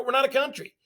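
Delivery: ordinary delivery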